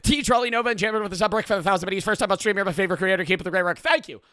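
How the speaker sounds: high, fast run-on thanks; breathless-paced streamer shout-out, high-pitched; rapid shout-out. Real, not acted. Rattles off a subscriber thank-you.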